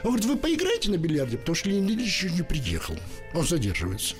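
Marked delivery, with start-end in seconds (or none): none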